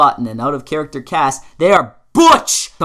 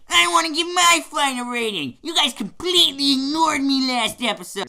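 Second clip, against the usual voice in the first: high-pitched sulky outburst; indignant complaint; 9 semitones higher than usual. Acted. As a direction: butt in, aggrieved at being left out. Bernard butts in, complains of being ignored.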